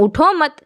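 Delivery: اٹھو مت as one unit, breath after مت; pause after mat. Shown as a demonstration. Teacher repeating the wrong phrasing for students. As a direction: stop right after مت. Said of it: "میں تو کہہ دی ہوں".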